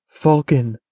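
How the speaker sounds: ordinary delivery, nothing marked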